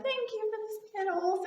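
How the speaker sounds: put-on very high child voice; little girl. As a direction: imitate a small child's cute thanks. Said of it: "the girl was like".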